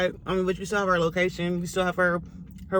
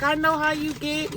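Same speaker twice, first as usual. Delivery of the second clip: higher mom voice; 9 semitones higher than usual. Retold or imitated. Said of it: "she's like".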